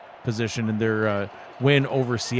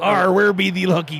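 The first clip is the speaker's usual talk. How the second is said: Irish attempt turning pirate, loud and higher; hammed-up pirate-sounding Irish brogue; failed Irish accent; 5 semitones higher, 6 dB louder than usual. Shown as a demonstration. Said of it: "I sound like a pirate".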